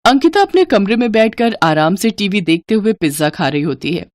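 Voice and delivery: usual speaking voice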